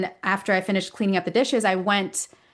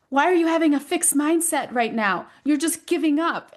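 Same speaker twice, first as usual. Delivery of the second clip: higher mock-scolding child voice; imitating the son lecturing mom; frustrated kid; 7 semitones higher than usual. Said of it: "he was getting frustrated with me".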